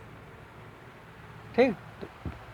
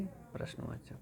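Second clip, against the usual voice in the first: whisper; 23 dB quieter than usual. Real, not acted. Soft aside.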